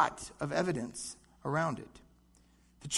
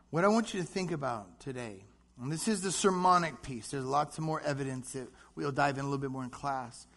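ordinary talk, nothing marked

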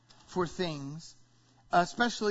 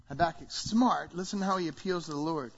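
normal talking voice

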